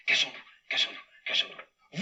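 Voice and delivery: urgent whispered hiding call; hushed conspiratorial whisper. Acted. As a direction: whisper so he won't hear. Hiding from Oscar.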